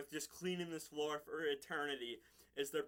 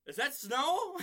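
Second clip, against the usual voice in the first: high, loud, rising question; hammed-up astonished question; mock wonder; 8 semitones higher, 6 dB louder, slower than usual. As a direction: imitate naive amazement. Character's acted wonder at foam.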